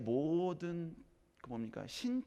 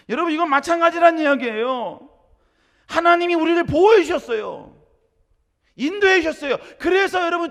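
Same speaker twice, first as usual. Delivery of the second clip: much higher, louder impassioned sermon; full-force preaching; 18 dB louder than usual. Acted. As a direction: thunder at the congregation. (목청 높여 외치는 설교)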